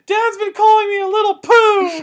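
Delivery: high, loud kindergartner's outraged yell. Retold or imitated. Kid bursts in after learning the word, high yelling. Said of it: "I just yelled".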